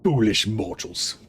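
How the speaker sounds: slow, otherworldly vampire lord; menacing undead noble drawl; Red Duke voice. Acted. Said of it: "That was my Red Duke voice".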